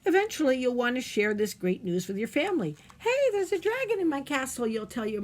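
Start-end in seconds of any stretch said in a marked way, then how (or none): 3.0-4.7 put-on high, loud, eager kid voice; excited child. Child calling out to mom about the dragon, excited. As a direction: burst out with the big news.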